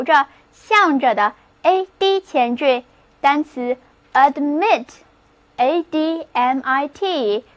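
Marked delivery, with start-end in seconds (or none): 4.1-4.9 "admit" slowly, with the stress on MIT; stress on second syllable. Shown as a demonstration. Teacher introduces the word to learners, second-syllable stress on "admit". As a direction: say "admit" with a weak first vowel, stressed MIT. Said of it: "重音放在第二个音节上".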